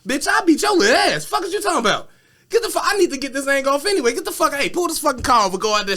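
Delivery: ready to fight, barking orders; triggered fury. Retold or imitated. Meg ordering the car stopped, furious. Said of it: "Now Meg trigger".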